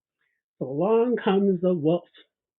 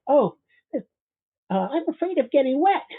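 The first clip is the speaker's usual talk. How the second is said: higher, hesitant little fearful voice; frightened gingerbread man; 8 semitones higher than usual. Acted. Gingerbread man telling the wolf he fears the water, high and hesitant.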